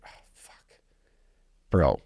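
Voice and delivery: breathy whisper of dismay; imitating future self, whispered; whispered regret. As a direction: whisper it like a sinking realization. Looking back years later.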